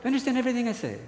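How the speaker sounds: put-on high, loud accented wonder with a laugh; marveling father. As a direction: imitate dad amazed.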